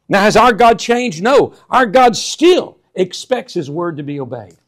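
slow, loud pulpit declaration; preaching at full force. Acted. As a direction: press each word on the congregation. Preacher builds to climax.

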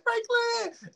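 shrieked, very high mock fan scream; screaming girls. Tucker's girls screaming his name, high shriek. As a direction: squeal the name like adoring girls.

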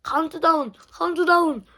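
높고 크게 신나서 외치기; 관중 앞 진행자처럼 외치기; excited shout. Real, not acted. 도전 전 흥분해 외침. (신나서 외치는 목소리)

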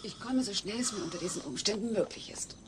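soft unvoiced whisper. Kate leaving for hospital, whispering. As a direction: whisper so Alf stays asleep. Quiet night departure.

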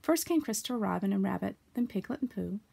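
ordinary delivery

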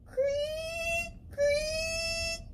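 very high creaking wail; creaky door voice. Acted. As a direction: squeal like a rusty hinge. Spooky door sound.

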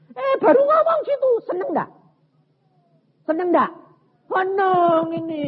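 high, loud excited exclaiming; acted delight. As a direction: gush with exaggerated pleasure. Memperagakan rasa senang berlebihan. (seruan senang, tinggi dan lantang)

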